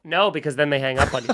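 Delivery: low, quick, matter-of-fact answer; instantly calm and reasonable; sudden calm. Real, not acted. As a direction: drop right down out of the rant. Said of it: "return to calm right there".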